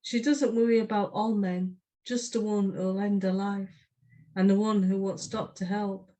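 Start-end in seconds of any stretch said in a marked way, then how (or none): none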